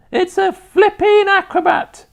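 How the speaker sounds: put-on very high, loud old-woman screech; Grandma's piercing cry; rooftop shout. A narrator's reading. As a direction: holler it down from the roof. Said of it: "Grandma shouted from the rooftop".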